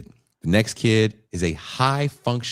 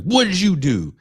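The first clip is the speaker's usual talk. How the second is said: high, loud gruff question done up high; prisoner asking; 12 semitones higher, 5 dB louder than usual. Retold or imitated. Prisoners ask the kids their crimes, high and loud. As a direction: demand it loudly, way up.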